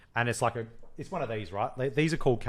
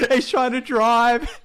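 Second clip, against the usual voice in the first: laughing; 7 semitones higher, 5 dB louder than usual. Real, not acted. Host retelling the drugged drive scene, laughing hard. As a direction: tell it while cracking up.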